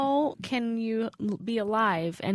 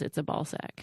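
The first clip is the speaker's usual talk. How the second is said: whispering; 3 semitones lower, 7 dB quieter than usual. Real, not acted. Repeated, dropped to whisper.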